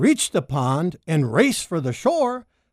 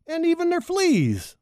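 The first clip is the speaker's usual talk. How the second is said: high squeak; 12 semitones higher than usual. A narrator's reading. Child voice, high.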